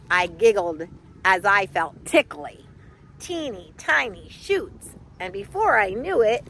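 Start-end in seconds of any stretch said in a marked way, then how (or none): none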